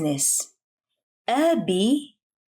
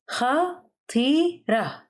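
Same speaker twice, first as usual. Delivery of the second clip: "خطيرة" slowly in three separate syllables, pitched up; 5 semitones higher, slower than usual. Shown as a demonstration. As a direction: pause between each syllable of "خطيرة". Vocabulary drill, broken into syllables.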